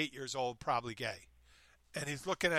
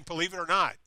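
punchy announcer-style believe it or not, louder; mock announcer catchphrase; 4 semitones higher, 9 dB louder than usual. A man mocks Ripley's slogan, announcer-style. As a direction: sell the slogan like a TV host. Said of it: "they're saying".

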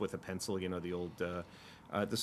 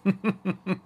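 loud, high burst of laughter; short real laugh; 11 semitones higher, 11 dB louder than usual. Real, not acted. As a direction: crack up briefly at your own impression. Laughs after imitating mom's shout.

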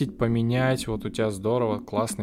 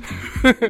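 laughing; 4 dB louder than usual. Real, not acted. Laugh after the yell.

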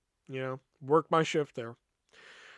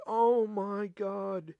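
long, drawn-out exasperated groan of words; exasperated dismay; 4 semitones higher, much slower than usual. Retold or imitated. Kiosk worker finding the messy back stock, exasperated dismay. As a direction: stretch it out slowly, appalled.

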